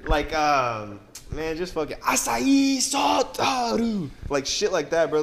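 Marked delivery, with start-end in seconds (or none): 2.0-4.1 high, excited mock commentator cry; mock Japanese ringside yell, pitched up; crazy Japanese announcer. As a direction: call it like a frenzied Japanese wrestling announcer. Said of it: "They be going crazy".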